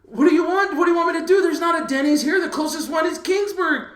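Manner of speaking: high, loud mock pleading; retold exasperated shout. Husband protests to pregnant wife about Denny's, exasperated. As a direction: shout it like a husband at wits' end.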